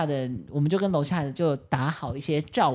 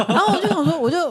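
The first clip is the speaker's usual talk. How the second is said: usual speaking voice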